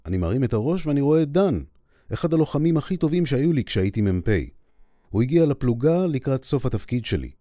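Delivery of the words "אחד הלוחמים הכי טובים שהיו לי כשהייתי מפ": plain reading voice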